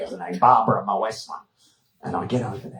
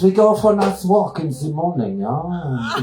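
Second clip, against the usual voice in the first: mock Austrian accent; 4 semitones higher than usual. Babsy voiced, Austrian accent.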